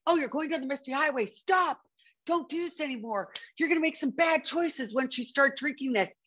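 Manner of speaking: a lightly voiced stern conscience saying no; sensible inner voice. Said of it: "It wasn't like".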